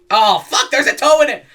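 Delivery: playful mock horror; mock shock. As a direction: yelp it like you just bit a toe. Narrator acting the kid's shock.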